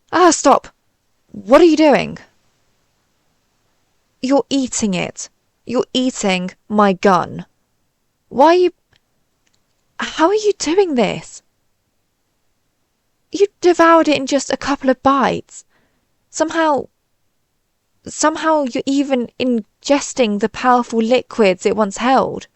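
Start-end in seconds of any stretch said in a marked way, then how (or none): 0.1-2.2 high, loud cry of alarm; horrified yelp, pitched up and falling; alarmed outcry. Acted. Alien sees his gun being eaten. 8.3-21.7 high-pitched, flustered alarm; rising panic, voice pitched up; horrified disbelief. Acted. Alien horrified as human devours gun.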